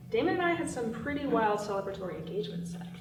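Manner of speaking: ordinary delivery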